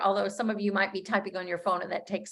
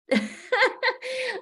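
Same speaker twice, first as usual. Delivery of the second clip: a sudden high laugh at her own slip; high burst of laughter. Real, not acted. Coach laughing at her own tongue slip, high laughter.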